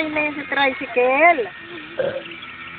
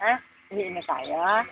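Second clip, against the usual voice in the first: ordinary delivery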